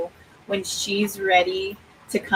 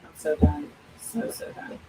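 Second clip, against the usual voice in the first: fading to a tired whisper; drained, dropping to a whisper; exhausted mutter; 5 semitones lower, 7 dB quieter than usual. Retold or imitated. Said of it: "I'm like".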